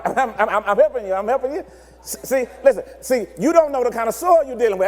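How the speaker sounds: ordinary delivery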